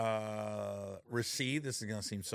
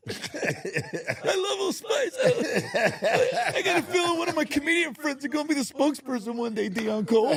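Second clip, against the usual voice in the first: squealed far higher and louder through laughter, slowly; mock ecstatic; 11 dB louder than usual. Young sailor gushing over his Old Spice gift, squealing high and laughing. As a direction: gush like a thrilled kid through giggles.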